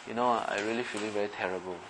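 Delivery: quietly confiding a real trouble. Shown as a demonstration. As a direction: drop the voice and open up. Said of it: "Can you sense a difference in the tone?".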